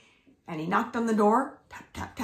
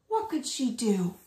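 usual speaking voice

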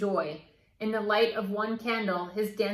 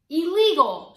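loud, high shout; shouting back; 8 semitones higher, 9 dB louder than usual. A narrator's reading. Meg shouts back at Gregory, a loud high yell. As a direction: yell it right back at him. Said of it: "shouted Meg back".

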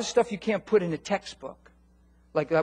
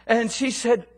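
usual speaking voice

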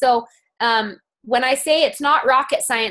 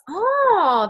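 mock interested oh; 9 semitones higher than usual. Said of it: "She goes".

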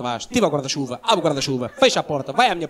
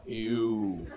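sheepish small voice; 7 semitones lower, 9 dB quieter than usual. Retold or imitated. Girlfriend admitting again.

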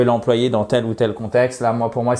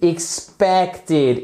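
"expected" slowly, stress on PEC; second-syllable stress; 4 semitones higher than usual. Shown as a demonstration. Teacher points out the stressed syllable, slow careful stress. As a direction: lean on the middle of "expected". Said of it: "vous avez l'accent tonique sur la".

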